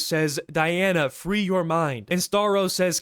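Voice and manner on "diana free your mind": plain reading voice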